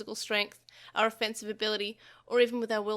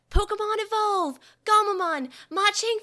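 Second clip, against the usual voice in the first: battle cry; 11 semitones higher, 7 dB louder than usual. A narrator's reading. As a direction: announce the attack with force. Digimon calling evolution and attack.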